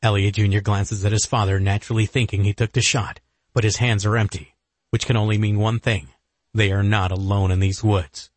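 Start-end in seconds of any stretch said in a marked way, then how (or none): none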